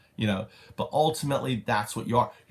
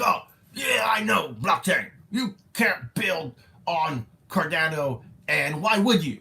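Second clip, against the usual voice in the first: mocking the dismissive engineer; 4 semitones higher than usual. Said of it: "He was like".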